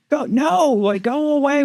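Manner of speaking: high, frantic calling out; urgent high go-away call; waving off. Retold or imitated. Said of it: "We're like".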